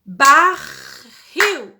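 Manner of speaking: teacher sounding out Barril syllable by syllable; split into syllables. Shown as a demonstration. Teacher to her class, spelling out a word in clapped syllables. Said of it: "vamos separar a palavra barril".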